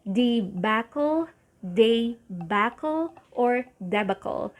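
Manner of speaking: debacle as dee-BACK-ul, day-BACK-ul, DEB-uh-kul; three takes of debacle; variant pronunciations. Shown as a demonstration. Teacher showing three ways to say debacle. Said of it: "you can either say".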